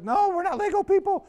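put-on high, childish voice of giggling kids; kids protesting. Students answering their teacher in a high childish protest. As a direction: squeak it back like a protesting child.

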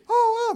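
high, loud mock wail; imitated alarmed cry. Daughter seeing parent fall on ice, imitated high loud cry.

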